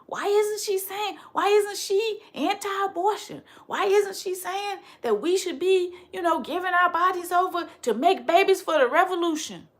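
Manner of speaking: high mock-incredulous questioning; mock critics. Voicing critics again.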